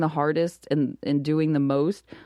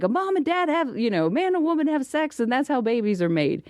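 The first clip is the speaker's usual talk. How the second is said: pitched up, calling out through a door; imitating a dad yelling the answer from the bathroom; mock yell from another room; 7 semitones higher than usual. Retelling how her husband called to their son from the bathroom, mock yell. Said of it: "Andy yells from the bathroom".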